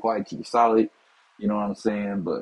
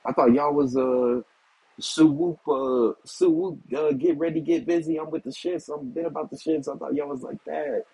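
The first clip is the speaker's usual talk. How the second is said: mocking tough talk; 6 semitones higher than usual. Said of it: "I'm like".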